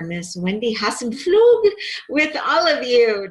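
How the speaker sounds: Hassenflug said high and loud, each syllable drawn out; careful name. Shown as a demonstration. Host carefully introduces her guest Wendy, name said high and drawn out. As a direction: sound out Hassenflug with effort. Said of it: "Did I say it right?".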